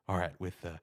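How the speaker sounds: low, soft sports announcer read; hushed announcer calling the pick. Shown as a demonstration. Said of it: "I started to talk very low".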